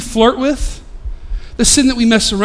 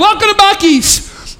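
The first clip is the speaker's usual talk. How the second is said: mock greeter welcome; 8 semitones higher, slower than usual. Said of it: "they're yelling".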